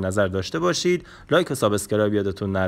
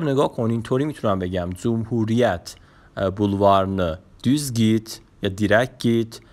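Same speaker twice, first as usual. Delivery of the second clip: normal talking voice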